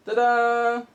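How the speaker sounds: high bright fanfare ta-da; triumphant ta-da. Pretend. Showing off the award with a high ta-da. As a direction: present yourself with a flourish.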